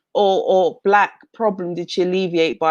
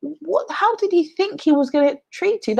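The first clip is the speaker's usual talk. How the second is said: much higher, astonished inner voice; incredulous thought; 12 semitones higher than usual. Retold or imitated. Reacting to a TV guest who came seeking acceptance, a high incredulous voice. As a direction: voice the disbelief sharply higher. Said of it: "And I thought".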